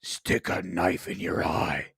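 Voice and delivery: low eerie ghostly voice; haunting voice. Retold or imitated. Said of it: "a ghostly voice going".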